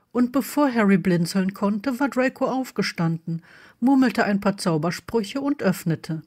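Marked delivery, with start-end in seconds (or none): none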